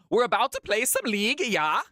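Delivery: a clumsy mock Finnish pep talk; bad Finnish accent. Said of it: "That was the worst attempt at a Finnish accent".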